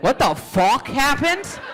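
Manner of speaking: high, drawn-out bewildered outcry; baffled disbelief. Acted. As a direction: stretch the question upward in disbelief. Imagined reaction on waking tomorrow.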